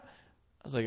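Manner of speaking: usual speaking voice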